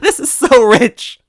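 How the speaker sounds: high, loud, slow wheezing through laughter; gasping between laughs. Acted. Toad laughing at the robot, gasping. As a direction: choke the words out mid-laugh. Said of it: "gasping between laughs".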